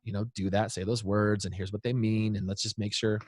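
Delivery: calm, composed parent. Acted. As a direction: talk it through quietly with your kid. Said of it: "take a breath and be like".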